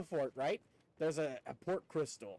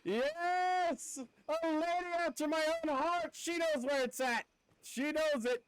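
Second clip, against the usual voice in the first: excited; 7 semitones higher than usual. Real, not acted. Excited reply to a fan.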